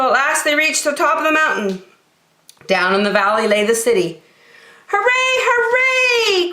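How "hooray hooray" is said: very high shout of joy; joyful hooray. Acted. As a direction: cheer at the top. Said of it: "cried the funny little clown".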